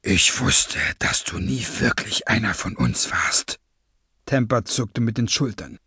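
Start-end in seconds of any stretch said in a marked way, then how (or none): 0.0-3.6 low, breathy villain voice; cold masked villain. Acted. Masked leader accuses a traitor, low and breathy.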